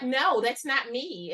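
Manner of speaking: slow, emphatic denial; mock denial. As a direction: draw it out in playful protest. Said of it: "you be like".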